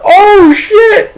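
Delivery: high, loud startled oh shit; shocked yelp. Retold or imitated. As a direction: yelp it as the ball lands. Catching the ball.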